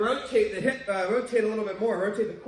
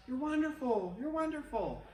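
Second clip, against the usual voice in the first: higher, fading softer; fading echo; 6 semitones higher, 4 dB quieter than usual. Retold or imitated. The valley echoes the praise back, fading. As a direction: echo the call back and let it die. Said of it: "And they heard back".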